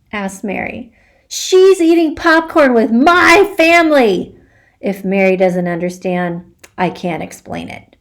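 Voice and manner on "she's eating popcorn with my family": indignant insist. Acted. Repeats news insistently.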